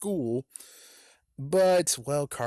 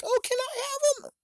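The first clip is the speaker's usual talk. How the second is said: put-on squeaky girl voice. Patty voiced in a retold story.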